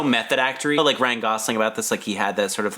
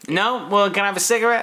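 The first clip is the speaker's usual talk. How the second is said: put-on higher, cheeky kid voice, laughing through it; mock streetwise teen; 8 semitones higher than usual. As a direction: play a brash teen asking for a smoke, half laughing. Mocking the streetwise girl actress.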